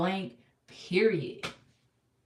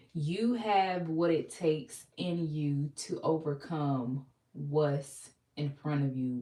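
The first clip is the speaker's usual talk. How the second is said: normal talking voice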